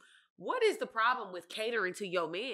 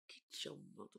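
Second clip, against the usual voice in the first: low, soft menacing mother voice; mom's scary voice; 11 semitones lower, 17 dB quieter than usual. Retold or imitated. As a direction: drop low like a mom about to snap. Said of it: "she had that voice".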